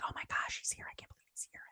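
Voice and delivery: hushed excited whisper; gossipy whisper. Retold or imitated. Everyone whispers as Daemon enters.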